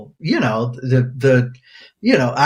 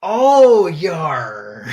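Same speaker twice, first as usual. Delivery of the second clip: pirate voice; 3 semitones higher than usual. Pretend. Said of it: "International Talk Like a Pirate Day".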